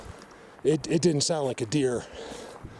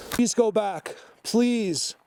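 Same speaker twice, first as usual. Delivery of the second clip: pleading; 9 semitones higher, much slower than usual. Real, not acted. Begging doe to return.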